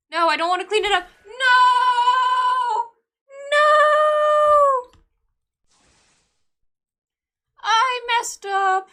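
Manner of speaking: loud, high wailing shout; long drawn-out, high-pitched yells; dramatic protest. Pretend. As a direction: cry out in hammed-up despair. Game mistake, protests loudly.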